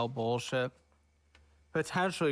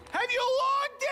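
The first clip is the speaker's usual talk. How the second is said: very high, louder demanding question; exasperated squeal; 4 dB louder, slower than usual. Real, not acted. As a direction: shriek it in disbelief at her. Impatient demand during roast.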